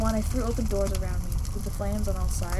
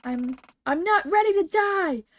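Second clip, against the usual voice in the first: terrified shout; 10 semitones higher, slower than usual. Acted. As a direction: scream that you don't want to die. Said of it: "I shouted that".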